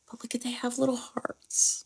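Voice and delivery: hushed whisper over her shoes. Real, not acted. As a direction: breathe it softly, delighted. Showing off her new shoes.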